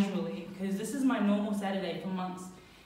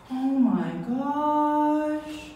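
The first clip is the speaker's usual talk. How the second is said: slow, drawn-out sung oh my gosh; stunned; 4 semitones higher, much slower than usual. Retold or imitated. Staring at the burning tree, slow stunned oh my gosh. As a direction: stretch it out in disbelief.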